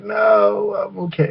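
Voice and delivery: high, drawn-out polite no; retold awkward refusal.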